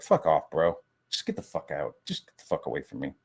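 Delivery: low, quiet dismissal; imagined dismissive brush-off. Retold or imitated. Turning down an imagined apology, low and dismissive. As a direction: wave someone away under your voice.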